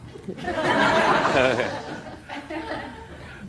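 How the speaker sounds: high breathless laugh at the joke; a real unvoiced laugh that rises; burst of laughter. Real, not acted. Laughs at judge's wisecrack.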